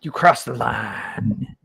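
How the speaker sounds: slow, drawn-out wrestler threat; wrestling promo. Shown as a demonstration. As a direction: ham up a pro wrestler line. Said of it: "pro wrestler style".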